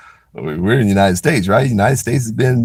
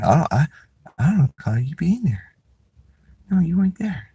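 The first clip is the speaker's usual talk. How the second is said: higher, fading to an unvoiced whisper; mock officer; 8 semitones higher, 4 dB quieter than usual. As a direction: play the officer dodging, voice sinking away. Imitating the officer.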